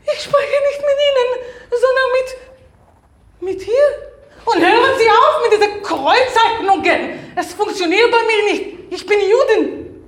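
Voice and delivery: high, loud possessed voice with laughter; a demon speaking through her. Acted. Possessed woman snaps at the man and the spirit, high and laughing. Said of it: "Der Dämon ist deine Mutter".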